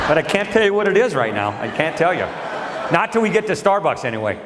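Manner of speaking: put-on high, sly child voice; kid voice. Son hinting at his coffee cake, high and sly. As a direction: tease coyly about the secret. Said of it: "He goes".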